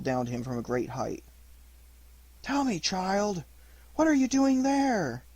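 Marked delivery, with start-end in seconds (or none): 2.4-5.2 a high, slow bird voice calling from above; a curious pigeon asking from the sky; gentle big pigeon. Acted. Pigeon calls down to Pinocchio.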